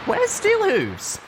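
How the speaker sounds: high, slow, worried question; alarmed high mare voice. Acted. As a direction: burst in looking for him. Pip bursting in, alarmed.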